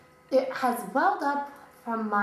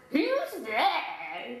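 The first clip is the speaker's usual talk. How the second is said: put-on old man voice; 2 semitones higher than usual. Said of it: "That's your old man voice?".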